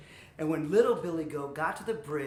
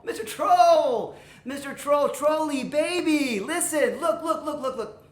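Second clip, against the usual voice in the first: loud, higher-pitched calling to interrupt; frantic wheedling; 8 semitones higher, 6 dB louder than usual. Acted. Middle goat sweet-talking the troll, urgently wheedling. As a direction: cajole urgently, piling up the words.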